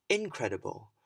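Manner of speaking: "incredible" with IN punched loud; stress on first syllable. Shown as a demonstration. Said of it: "I stressed the different parts".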